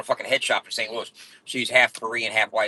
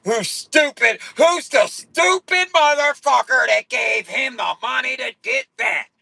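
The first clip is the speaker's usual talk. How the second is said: high, slow mock-outraged shouting haters; 9 semitones higher, slower than usual. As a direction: shout like jealous haters losing it. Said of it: "They be like".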